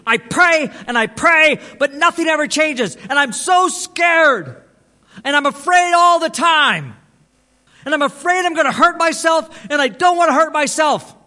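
imitating a frantic man's wailing plea; desperate shouting. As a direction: cry it out right in the listener's face. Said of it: "he got right in my face".

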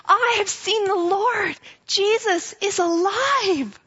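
joyful shout. Acted. Mary running to tell the disciples, joyful shout. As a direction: proclaim it with joy. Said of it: "shouting and laughing all the way".

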